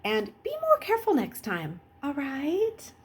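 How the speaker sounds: lightly voiced, far-off old woman; gentle scolding; old nurse. Nurse sending the boys off with a mild warning. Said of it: "the woman said".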